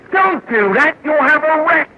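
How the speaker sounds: high, urgent cry of warning; mock-panicked, high-pitched protest; alarmed warning. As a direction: cry out to stop a disaster. Cries warning at quoted AA member.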